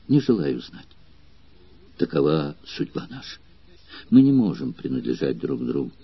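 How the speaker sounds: soft, tearful, quiet lament; mild sob in a hushed voice; sobbing bitterly. A narrator's reading. Girl weeps as she sends her lover away, softly tearful. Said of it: "горько рыдая".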